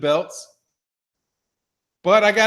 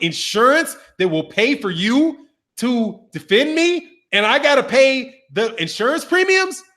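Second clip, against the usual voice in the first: higher-pitched, disbelieving recap; incredulous; 5 semitones higher than usual. Retold or imitated. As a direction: question it in mounting disbelief. Retells his stunned reaction to deal.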